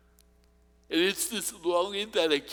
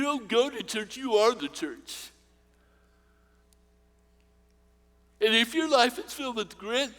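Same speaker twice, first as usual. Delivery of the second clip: raised, higher preaching voice building to the close; 5 semitones higher, 4 dB louder than usual. Acted. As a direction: lift it up and preach hope to the crowd. Closing sermon exhortation.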